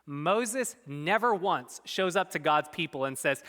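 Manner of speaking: ordinary talk, nothing marked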